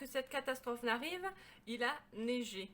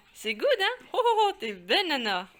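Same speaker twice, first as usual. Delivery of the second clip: talking to a dog; 4 semitones higher, 11 dB louder than usual. Real, not acted. Praising the dog.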